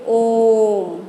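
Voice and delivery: ओम with the ओ held very long and slow, falling at the end; prolonged pluta vowel. Shown as a demonstration. Teacher demonstrates the pluta vowel by drawing out ओम very slowly. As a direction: stretch out the ओ of ओम as if calling out. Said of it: "पुकारते समय जिस स्वर का देर तक उच्चारण होता है".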